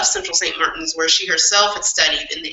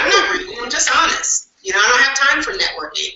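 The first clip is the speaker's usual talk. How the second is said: lightly voiced blunt professor, much higher; a hint of the straight-talking professor, higher, fast at the end; quoting the blunt professor; 7 semitones higher, faster than usual. Quoting the late professor to co-hosts, mildly blunt and much higher. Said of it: "She's like".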